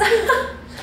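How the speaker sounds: bright high-pitched giggle; a quick squeaky laugh. Real, not acted. Laughing at sauce name.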